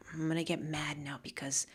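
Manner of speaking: very low, near-whispered pretend planning; hushed fake decision; mock deliberation. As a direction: mutter it to yourself, half-hearted. Mock choosing to get mad.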